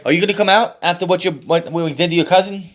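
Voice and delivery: lightly acted wrestler's taunt. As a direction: goad your rival over the mic. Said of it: "taunting him in the mic".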